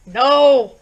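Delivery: a loud, sudden "No!"; sharp refusal. A narrator's reading. Wife cutting off husband's plan, loud sharp no. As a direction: cut him off loudly.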